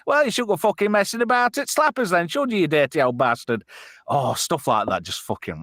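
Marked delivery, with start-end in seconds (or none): 0.1-3.6 high mock scolding, dropping at the end; hammed-up comic-dub telling-off; Postman Pat spoof. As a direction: tell someone off as a high-voiced spoof postman. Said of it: "a badly dubbed video".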